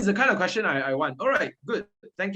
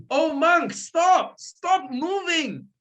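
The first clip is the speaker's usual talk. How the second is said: much higher, loud yell, stretched out slowly; bandit's shout; 11 semitones higher, 5 dB louder, much slower than usual. Acted. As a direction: bellow after the fleeing monk. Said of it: "I'll be the Angulimala".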